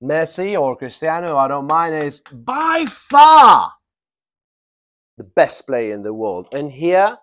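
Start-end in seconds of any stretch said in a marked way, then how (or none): 2.3-3.7 BY FAR loud, high and drawn out; emphatic stress. Shown as a demonstration. Teacher showing how to strengthen a superlative, very emphatically. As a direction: belt out "by far" with a long FAR. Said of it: "I'm emphasizing the superlative".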